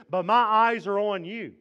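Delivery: loud, rising, resolute vow; determined declaration. Pretend. Preacher voicing Jehoshaphat's defiant vow, loud. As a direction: declare it like a battle cry. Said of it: "This is an act of".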